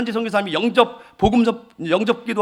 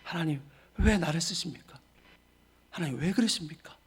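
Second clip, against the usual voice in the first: tearful plea; 4 semitones lower, 11 dB quieter than usual. Retold or imitated. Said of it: "울기만 했어요".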